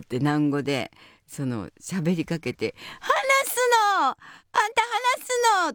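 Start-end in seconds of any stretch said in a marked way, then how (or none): 3.0-5.7 put-on very high, slow Kansai baby talk; drawn out in Kansai dialect to a baby; a comedian asking a baby in Kansai dialect, very high. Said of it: "わかんない関西弁で".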